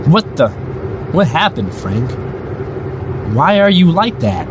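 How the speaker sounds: high-pitched disbelief, voice jumping up; shocked. Acted. Driver confronting faceless Frank, shocked and high. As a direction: recoil in horror at what you see.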